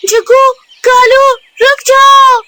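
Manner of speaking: high, loud shout to stop them; a bird shouting stop in the dark; shouted command. Acted. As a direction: yell at them to halt. Gauri stops night attack.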